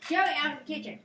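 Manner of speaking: sharp high shout at the cat; scolding shout. Real, not acted. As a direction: yell the cat out of the kitchen.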